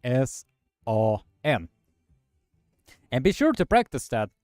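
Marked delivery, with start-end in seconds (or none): 0.0-1.7 R O S S A N spelled letter by letter slowly; slow letter-by-letter spelling. Shown as a demonstration. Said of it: "Det vill säga K".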